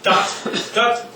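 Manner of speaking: staccato dots; short detached dots. Shown as a demonstration. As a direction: tap out each dot short and climbing. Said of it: "like a dot".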